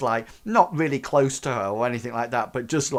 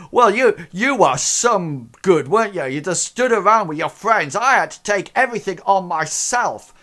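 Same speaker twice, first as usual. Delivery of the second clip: smug gamer; 7 semitones higher, 8 dB louder than usual. Acted. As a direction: rub their noses in it. Crank blames teammates, GM voicing.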